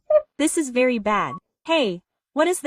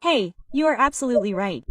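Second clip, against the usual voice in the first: normal talking voice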